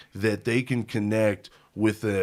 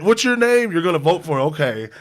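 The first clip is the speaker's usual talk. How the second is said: high, louder playful phone voice; joking phone chat with Obama; mock phone call; 5 semitones higher, 5 dB louder than usual. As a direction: pretend to call him up, laughing. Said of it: "Let me call him".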